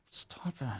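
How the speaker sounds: breathy whispered "stop it"; whispered aside between wife and husband. Retold or imitated. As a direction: hiss it under your breath. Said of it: "she goes".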